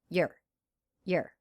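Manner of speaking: "you're" as a very short "yer"; reduced contraction. Shown as a demonstration. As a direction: clip "you're" down to one quick syllable. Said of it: "the contraction of you are".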